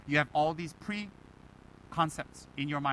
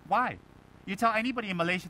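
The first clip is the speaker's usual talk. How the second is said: usual speaking voice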